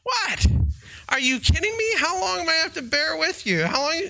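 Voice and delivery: perturbed exasperation. Retold or imitated. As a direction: play a woken, annoyed leader scolding. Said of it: "he's a little perturbed".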